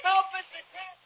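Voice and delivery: high, frantic call for help; cry for help. Acted. Boy trapped in the flooding room cries to Mister Kent for help. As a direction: shout for help as the water rises.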